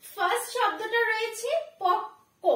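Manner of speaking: ordinary delivery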